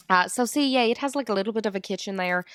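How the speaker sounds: usual speaking voice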